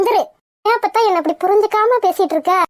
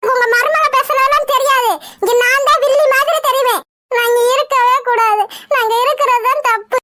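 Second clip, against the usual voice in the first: higher-pitched, wronged and close to tears; tearful complaint; 4 semitones higher than usual. Acted. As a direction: whine through mock-hurt tears as the wronged one. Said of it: "அழுகாத". (அழுகையோடு புலம்பும் குரல்)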